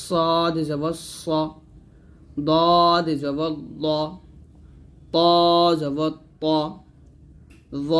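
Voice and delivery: very slow, drawn-out "সদ জবর স", each heavy letter said thick. Shown as a demonstration. Teacher reading out the examples, very slowly. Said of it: "প্রত্যেকটা উদাহরণ দেওয়া আছে".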